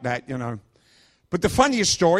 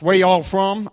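higher, louder Alabama drawl; Alabama accent; 5 semitones higher, 7 dB louder, slower than usual. Retold or imitated. Driver quizzing the hitchhikers, in a mock Alabama accent. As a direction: put on a rough-and-ready Alabama accent. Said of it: "I can't do an Alabama accent".

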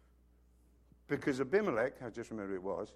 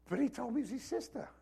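king pleading fast; 8 semitones higher, 5 dB quieter, faster than usual. Retold or imitated.

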